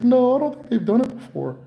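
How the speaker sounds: high, certain denial; imitating the patient brushing off the correction. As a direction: insist up high. Patient voice in retold argument.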